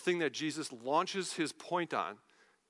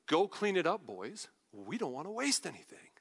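understated paraphrase. Retold or imitated. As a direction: toss it off coolly to the crew. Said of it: "I'm gonna paraphrase this for you".

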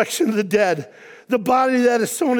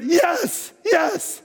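dad's glad yes; 7 semitones higher than usual. Retold or imitated. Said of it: "But he went".

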